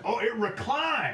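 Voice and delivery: high, slow put-on moviegoer; mock-impressed drawl about the seats; bad acting. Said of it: "He's just like".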